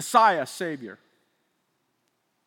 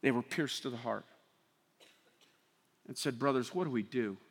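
much softer and lower; hushed; 11 semitones lower, 13 dB quieter than usual. Real, not acted. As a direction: drop to a near hush. Hushed retelling.

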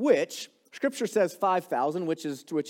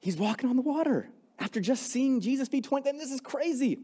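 amazed; 8 semitones higher than usual. Real, not acted. Amazed at Peter walking on water.

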